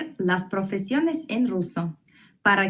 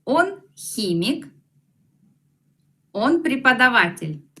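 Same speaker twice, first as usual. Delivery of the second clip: slow repeat model; 3 semitones higher than usual. Shown as a demonstration. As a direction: repeat slowly for the class. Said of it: "Repetid, por favor". (repetición lenta)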